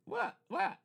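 high squeaky confused what; mock baffled squeak. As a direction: imitate your own bewilderment. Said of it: "I was just like".

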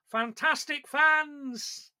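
high, slow, drawn-out put-on voice; Frank Sidebottom catchphrase. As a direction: stretch it out like a puppet-headed comic. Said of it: "as Frank used to say".

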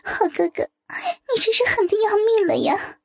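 high, tearful wail of pain; high-pitched pained protest; pained crying out. A narrator's reading. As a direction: cry out as if it really hurts. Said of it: "叫死叫活地喊道".

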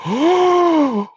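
loud, very high shocked gasp; collective gasp. Retold or imitated. Everybody sees the star fall over the railing, shocked gasp. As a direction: suck in air like a horrified crowd. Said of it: "everybody goes".

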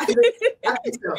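high, breaking into real laughter; laughing. Real, not acted. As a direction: answer while cracking up. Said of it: "'Cause I'm cracking up".

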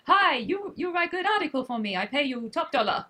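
imitating a spammy foreign emailer; teasing pidgin voice of a spammer; mock Indian accent. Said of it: "someone from India, you know, just contacting us".

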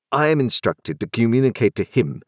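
a crisp, authoritative London lawyer; stern lawyer voice. A narrator's reading.

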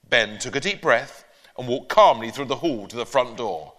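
usual speaking voice

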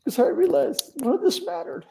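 high, cracking, tearful voice; real crying. Real, not acted. Recalling surrender to the hosts, openly crying. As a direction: let the tears break through every word. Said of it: "I'm bawling now".

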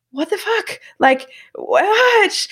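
high, drawn-out what; mock outrage held in; asking in shock about the vodka bowls; stunned disbelief. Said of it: "being like".

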